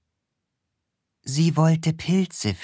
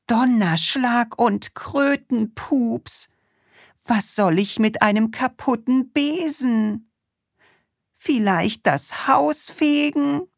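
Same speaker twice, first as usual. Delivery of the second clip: witch calling out; 11 semitones higher than usual. Acted. The witch finds her broom broken, high flustered exclaiming. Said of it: "Die Hexe rief".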